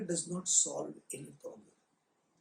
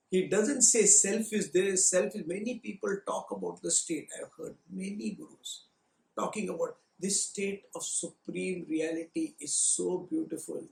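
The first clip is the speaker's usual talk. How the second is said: ordinary talk, nothing marked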